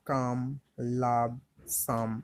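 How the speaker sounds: কাম, লাভ, সাম each with an "aa" vowel; O pronounced "aa". Shown as a demonstration. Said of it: "আয়ের মতন হবে". (কাম, লাভ, সাম-এ O-এর উচ্চারণ "আ")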